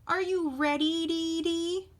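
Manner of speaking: high, sustained bird-like call; chickadee call. A narrator's reading. Chickadee asking the animals, high chirping call. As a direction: hold the pitch up and sing out the ending. Chickadee keeps calling to others.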